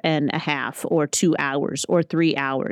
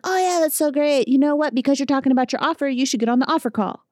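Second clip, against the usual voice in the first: much higher, breezy brush-off voice; mock chipper staff; 8 semitones higher than usual. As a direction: parody the upbeat coaching staff, pitched up. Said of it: "they were like".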